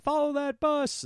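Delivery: rally everyone after the bus; urgent call. Retold or imitated. Family chasing the departing bus, urgent high shout. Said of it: "We're like".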